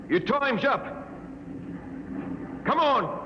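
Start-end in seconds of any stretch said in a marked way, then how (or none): 2.6-3.2 higher-pitched, impatient summons; impatient call. Acted. Calling the punished man out of the airlock, impatient call.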